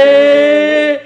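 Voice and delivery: high, loud drawn-out "eh!"; excited whoop. Real, not acted. Host reacting to the couple's private post, excited whoop. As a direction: let out a long, high squeal. Whole room reacts teasingly.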